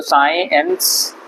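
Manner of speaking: साइंस stretched slowly into sai-ens; slow demonstration of साइंस in two parts; silent c. Shown as a demonstration. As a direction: draw out साइंस with no k sound. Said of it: "इसलिए सी silent है".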